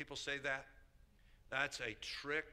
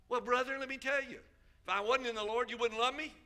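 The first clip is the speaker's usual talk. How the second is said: high, louder mock lecture; mock retort; 9 semitones higher, 5 dB louder than usual. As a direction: set him straight, half joking. Acted church dialogue.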